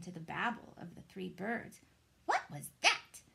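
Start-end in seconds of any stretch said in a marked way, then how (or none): 2.3-3.2 high, loud startled cry; a baby bird cries out in alarm. A narrator's reading. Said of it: "cried Flap".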